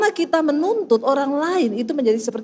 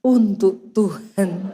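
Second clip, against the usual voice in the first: slow, low, measured words; laboured devotion; 8 semitones lower, much slower than usual. Retold or imitated. Wife stirring coffee for God, slow and deliberate. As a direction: draw it out in comic restraint.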